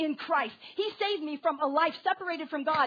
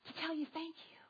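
breathless whisper; 3 semitones higher, 10 dB quieter than usual. Acted. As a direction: whisper the last thanks, voice gone. David's prayer fades out.

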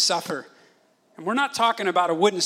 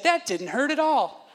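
put-on higher-pitched little-kid voice; imitated defiant child; 7 semitones higher than usual. Dad imitating his son right after the whack, suddenly unbothered. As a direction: lightly mimic a kid brushing off punishment.

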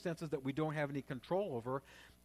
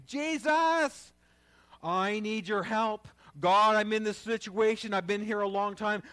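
loud, high, pleading cry to God; a preacher crying out to Jesus for a breakthrough, loud and high; fervent loud prayer; 4 semitones higher, 6 dB louder than usual. Shown as a demonstration. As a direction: pray it out at full force, begging. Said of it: "You gotta pray like that".

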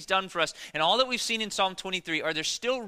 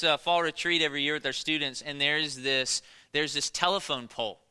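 normal talking voice

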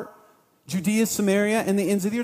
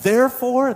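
"Therefore" punched louder, falling; stress on "Therefore"; 4 semitones higher, 6 dB louder than usual. Shown as a demonstration. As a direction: hit "Therefore" like a drumbeat. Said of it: "that's serious".

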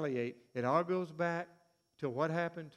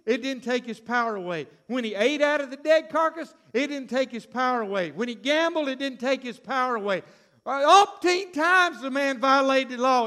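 louder preacher cadence, each refrain hammered; preaching at full force; 5 semitones higher, 8 dB louder than usual. Acted. Preacher hammering the refrain to the crowd, louder. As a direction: drive the refrain home to the congregation.

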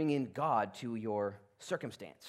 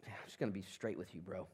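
fast, quieter, offhand; casual quick aside; 8 semitones lower, 10 dB quieter, faster than usual. Acted. God leveling with Gideon, quick and casual. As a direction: toss it off like a buddy.

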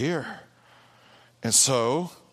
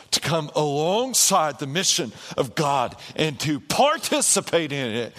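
normal talking voice

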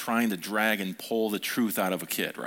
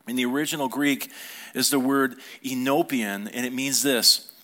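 ordinary talk

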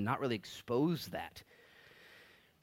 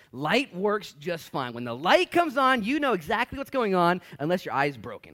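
preaching at full force; 9 semitones higher, 11 dB louder than usual. Real, not acted. Preacher's loud climactic point.

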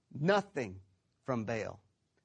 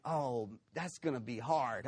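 lower, softer, mildly daunted grumble; mock reluctance; 4 semitones lower, 6 dB quieter than usual. Preacher voicing what the people didn't say, mildly daunted. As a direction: imitate someone daunted by a hard task. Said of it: "the people weren't like".

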